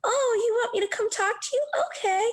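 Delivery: high, sugary fake-sweet reply; mock sweet girl. Singer mocking how she must answer the man sweetly. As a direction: put on a sickly sweet smile voice. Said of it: "still be sweet and have a smile".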